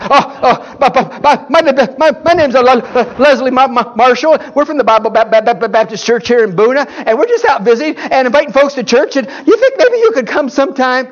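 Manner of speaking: a lightly voiced, nervous young wife; nervous stammering wife. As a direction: play the shy wife stumbling through her first door pitch. Wife stammers at a stranger's door.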